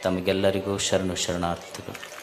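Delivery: soft, low, devout opening greeting; solemn invocation. Acted. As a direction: call on the guru reverently before the talk.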